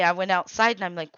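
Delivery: normal talking voice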